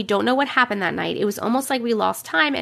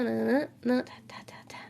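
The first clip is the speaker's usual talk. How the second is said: whisper; 8 dB quieter than usual. Real, not acted. Whispering while waiting.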